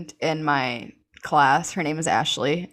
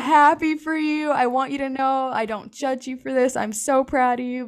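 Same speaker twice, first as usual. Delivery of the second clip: crying mom; 9 semitones higher than usual. Retold or imitated. Mother tearfully tells her daughter she's proud, a teary imitation. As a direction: mimic a mother tearing up with pride. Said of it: "starts crying".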